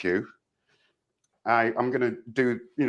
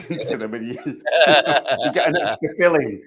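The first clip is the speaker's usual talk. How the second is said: laughing; 4 semitones higher, 4 dB louder than usual. Real, not acted. Joking about biting foil.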